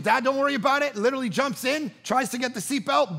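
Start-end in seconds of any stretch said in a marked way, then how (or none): 0.0-1.0 put-on breezy kid brushing dad off, higher and louder; lightly voiced ten-year-old, raised pitch; imitated young daughter. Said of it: "and she goes".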